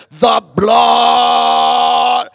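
loud drawn-out cry; retold shout. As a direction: shout it out to the crowd. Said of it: "we shouted".

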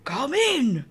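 wheezing fake granny voice; wheezy granny. A narrator's reading. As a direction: wheeze it like a sick old granny. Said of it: "wheezed Granny".

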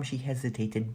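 ordinary delivery, nothing marked

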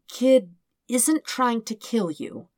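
slow, careful, deliberate words; slowly. Acted. Farah calmly correcting Dirk's wild theory, slow and careful. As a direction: spell it out slowly for him. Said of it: "Farah says slowly".

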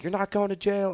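high-pitched mock yell; imitated screaming. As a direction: imitate someone screaming a scolding. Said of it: "screaming at me".

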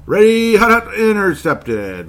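loud, high quarterback cadence stretched out; high-pitched mock play-by-play holler; mock play call. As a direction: holler the snap count, drawing out the last word. Acting out the snap and interception.

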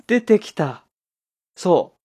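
gasp, then a high, loud burst; delighted cry as it suddenly comes back to you; real excited surprise, pitched right up; excited discovery. Real, not acted. Finds the remembered book, delighted.

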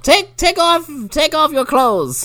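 put-on high insistent old woman; mock old lady demanding. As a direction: order him to strip in a granny voice. Old lady ordering him to strip.

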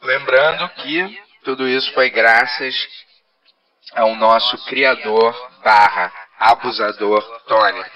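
slow, loud robotic announcement. Acted. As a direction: drone it out like a machine. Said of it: "essa voz de robô".

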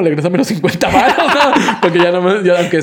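laughing through the words. Real, not acted. Narrator to his cohost, laughing about asking for his tip back.

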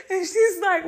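normal talking voice

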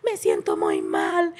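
slow, drawn-out imitated wail; mock sobbing outburst; retold crying shout. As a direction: act out a daughter's tantrum cry, stretching each word. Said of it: "Llorando, gritando, así pataleando, me dijo".

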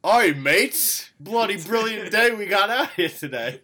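loud, high Australian greeting, first word drawn out; bad Australian accent. Contestant introduces his character to the hosts, loud bad Australian accent. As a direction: open big in a hammy Aussie accent. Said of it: "the fucking worst Australian accent".

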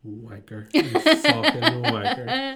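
high, slow mock insult through laughter; laughing curse. Storyteller jeering at the boss as the Coke bursts, laughing.

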